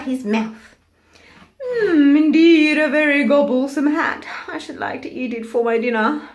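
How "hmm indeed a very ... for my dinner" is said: loud, higher, hungry crocodile drawl. Acted. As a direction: savour every word like a greedy crocodile. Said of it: "drawled the crocodile".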